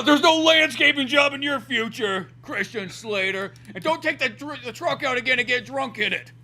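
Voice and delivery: high, slow, strained dad rant; drawn-out mock tirade, starting loud; hammed-up scolding dad. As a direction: play an angry father lecturing his son. Acting dad scolding his son.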